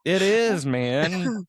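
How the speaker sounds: high loud laughing protest; loud excited. Real, not acted. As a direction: insist it loudly through laughter.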